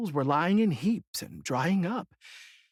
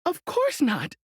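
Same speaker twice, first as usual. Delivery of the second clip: put-on higher child's voice; girl's answer; 10 semitones higher than usual. A narrator's reading. Dorothy answering the witch, higher girl's voice. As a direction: answer plainly as a little girl. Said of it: "answered Dorothy".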